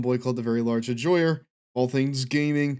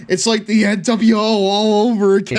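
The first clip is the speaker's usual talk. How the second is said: hype shout; 9 semitones higher, 9 dB louder than usual. Pretend. Hyping a wrestling stable entrance.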